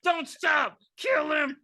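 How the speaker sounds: high shrieking killer doll; Chucky shouting. Acted. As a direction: scream it like an evil doll. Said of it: "here Chucky on the desk".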